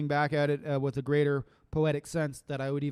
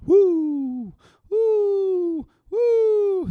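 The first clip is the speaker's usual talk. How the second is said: much higher, louder cheering woo; real celebratory whoops; excited whoops; 9 dB louder, much slower than usual. Real, not acted. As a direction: whoop with joy. Cheering after poem.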